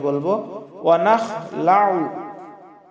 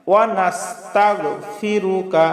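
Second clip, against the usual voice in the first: usual speaking voice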